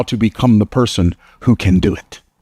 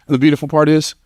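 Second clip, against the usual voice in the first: ordinary delivery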